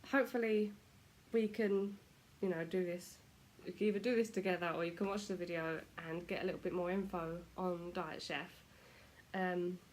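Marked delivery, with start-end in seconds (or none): none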